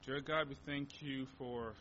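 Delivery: ordinary delivery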